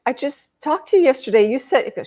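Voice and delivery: disbelieving protest. Retold or imitated. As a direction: blurt it out, thrown by the news. Shocked reply to frantic sister.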